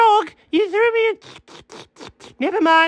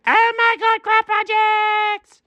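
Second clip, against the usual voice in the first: excited squeal; slower than usual. Acted. Thrilled by craft project.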